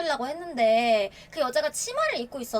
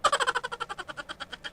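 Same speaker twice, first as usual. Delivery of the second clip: high, bursting laugh; a sudden high-pitched laugh; 9 semitones higher, 4 dB quieter than usual. Real, not acted. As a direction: let a high laugh escape.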